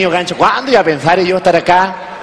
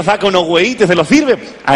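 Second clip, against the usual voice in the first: normal talking voice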